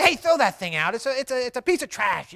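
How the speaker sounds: hammed-up disgusted outburst; mock harsh scolding. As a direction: play a preacher loudly condemning it. Mock harsh preacher, contrasted with kind one.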